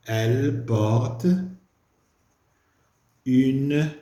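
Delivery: elle porte une robe propre stretched out slowly; slow dictation. Shown as a demonstration. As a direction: dictate the answer word by word. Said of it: "dictating answer slowly".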